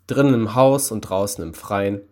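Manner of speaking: usual speaking voice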